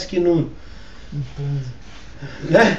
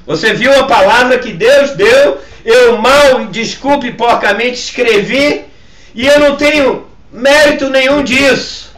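emphatic, raised preaching voice; forceful preaching; 3 semitones higher, 5 dB louder than usual. Real, not acted. Pastor refusing credit for the word before his congregation, forceful preaching. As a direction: drive each phrase home to the congregation.

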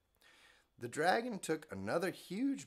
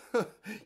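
mocking laugh; 8 semitones higher, 6 dB louder than usual. Ronald mocking her.

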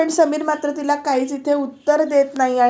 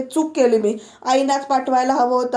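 normal talking voice

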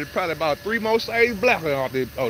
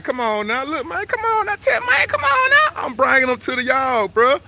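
high-pitched, worked-up coaxing; excited urging; 9 semitones higher than usual. Real, not acted. Man urging his gator to come on, excited. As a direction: plead and cheer, voice climbing high.

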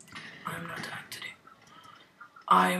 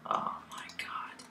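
slow, soft despairing whisper; 16 dB quieter, slower than usual. Real, not acted. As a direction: breathe it out defeated. Losing, exhausted.

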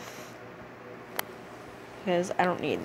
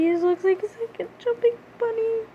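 high squeal; 12 semitones higher than usual. Shown as a demonstration. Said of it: "Looks like a jumping bunny".

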